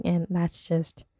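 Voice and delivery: normal talking voice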